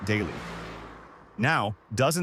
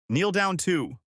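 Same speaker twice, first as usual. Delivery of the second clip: a touch of a raised shout; woman shouting; 6 semitones higher, slower than usual. Acted. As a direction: call it out from the crowd. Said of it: "one woman shouted".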